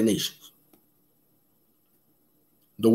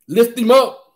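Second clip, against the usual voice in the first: higher, ringing call to lift him up; exclaimed praise; 10 semitones higher, 4 dB louder than usual. Real, not acted. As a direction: cry it out in praise.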